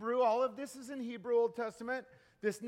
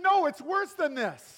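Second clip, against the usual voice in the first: louder, higher insistent correction; raised, high voice for the punchline setup; 6 semitones higher, 5 dB louder than usual. Acted. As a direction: push back emphatically on the word no. Man corrects friend in joke.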